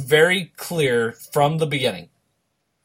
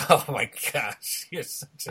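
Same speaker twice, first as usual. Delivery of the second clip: laughing; 5 dB quieter, slower than usual. Real, not acted. Laughing at cohost's excuse.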